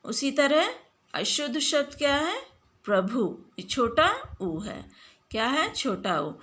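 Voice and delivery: ordinary talk, nothing marked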